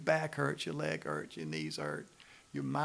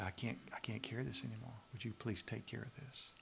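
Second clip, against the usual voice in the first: much softer, subdued prayer; soft plea; 11 dB quieter than usual. Acted. Believer at the table asks Jesus for help, pleading softly. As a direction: hand it over quietly, as a humble request.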